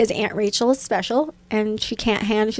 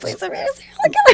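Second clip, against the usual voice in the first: much higher, faster, breaking into laughter; laughing mock plea; faster than usual. Parent pleading over the bag, laughing and much higher. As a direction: beg again while cracking up. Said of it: "Just put your hand in the bag and like".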